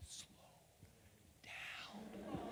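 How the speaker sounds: breathy hushed whisper across the table. Retold or imitated. Boss whispering across the read-through table, hushed. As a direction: mouth it softly so only he hears. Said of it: "She goes".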